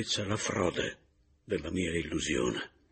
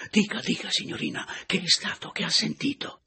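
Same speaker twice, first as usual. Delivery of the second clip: higher, louder, hurried concern; eager urging; 5 dB louder than usual. A narrator's reading. The old host urges the frightened lady to speak. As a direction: press her eagerly to speak. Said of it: "la spinse a dire premurosamente".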